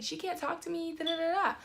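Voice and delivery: high mimicked complaint trailing into babble; mocking imitation. Imitating the offended passenger, mocking high complaint.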